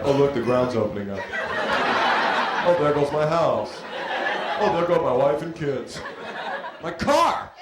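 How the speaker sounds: increasingly giddy, high Californian shrugging off the quake; mock carefree Californian. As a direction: play the unbothered local cheering as his life collapses. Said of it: "It's like".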